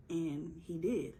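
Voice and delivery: ordinary talk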